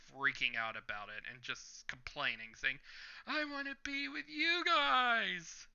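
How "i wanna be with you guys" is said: high, slow mock panic wail, lightly done; a hammed-up frightened cry, pitched way up; panicked wail. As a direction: whine it like a scared recruit marching to his death. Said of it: "he's just freaking out".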